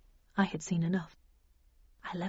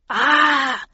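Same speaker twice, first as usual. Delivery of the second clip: loud, raised "ah" scream; cry of alarm; 5 semitones higher, 7 dB louder than usual. A narrator's reading. Bradshaw staggering back from the virus, loud alarmed cry. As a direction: cry out as if struck. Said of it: "he cried".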